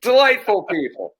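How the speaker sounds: dry irony; joking about the rude strangers; sarcastic. Real, not acted. Sarcastic comment after story.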